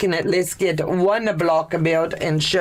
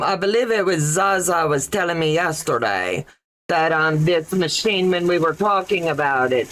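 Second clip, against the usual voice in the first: usual speaking voice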